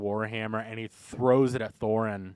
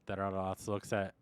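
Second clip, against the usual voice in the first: usual speaking voice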